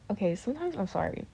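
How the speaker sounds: ordinary talk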